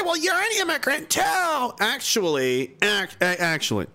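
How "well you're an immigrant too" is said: mocking falsetto. Mocking what critics say.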